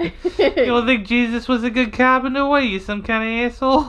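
a mock-offended Jersey wiseguy; funky fresh tough guy. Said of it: "I like this funky, fresh person you've created".

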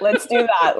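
a real laugh running through the words; laughing agreement. Real, not acted. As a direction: say it while still laughing. Agrees, laughing at host's joke.

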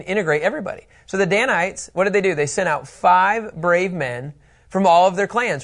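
ordinary talk, nothing marked

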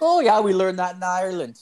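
lightly hammed-up Irish drinker voice; old Irish guy. Imitating Tommy the old Irish drinker, high-pitched.

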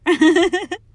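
high loud burst of laughter; sudden loud laugh. Real, not acted. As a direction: laugh it off loudly. Laughs after cursing ex.

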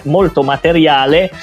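ordinary talk, nothing marked

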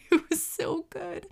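high, soft laughing voice. Real, not acted. Gushing to listeners about the sushi, laughing. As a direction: squeak it out through a laugh. Laughing after praising the food.

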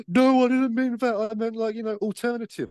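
very high, panicked mock apology; flustered backpedalling. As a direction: squeak out a horrified correction. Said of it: "she was like, gasps".

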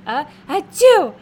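loud, high acted sneeze; mock sneeze. Girl with sinus trouble sneezing, acted out. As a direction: wind up, then burst into a sneeze. Imitates the comic girl's sneeze.

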